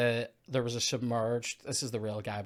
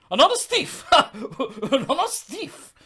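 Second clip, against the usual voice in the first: high, loud cry breaking into laughter; hammed-up outraged yell; mock accusing shout; 11 dB louder, slower than usual. Acting out a film outburst, laughing.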